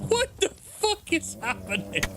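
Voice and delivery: real laughter taking over the words; helpless laughter. Real, not acted. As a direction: dissolve into a long laughing fit. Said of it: "I'm laughing so much".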